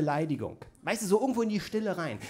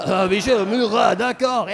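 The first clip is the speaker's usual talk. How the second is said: loud, grand French affectation; hammed-up French; 6 dB louder than usual. Pupil back from French class, hammed-up French. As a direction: gush like a self-important French speaker. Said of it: "aus dem französischen Unterricht kommen".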